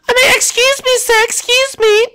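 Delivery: high falsetto princess voice; girl voice. Acted. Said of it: "I'll do a girl voice".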